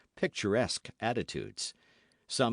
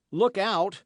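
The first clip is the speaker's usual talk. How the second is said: sharp warning shout, higher and louder; cry of alarm; 7 semitones higher, 5 dB louder than usual. Acted. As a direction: call out the danger to the others. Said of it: "cried D'Artagnan".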